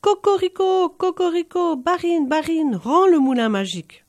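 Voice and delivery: high, loud rooster cry shouted at the barine; loud high crowing cry; rooster crowing call. A narrator's reading. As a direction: crow it out like a cockerel on the gate. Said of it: "se mit à crier".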